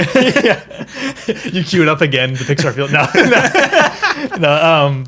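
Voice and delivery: high, loud, through laughter; laughing loudly. Real, not acted. Host laughing at co-host's interruption, speaking through laughs. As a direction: laugh it off while talking.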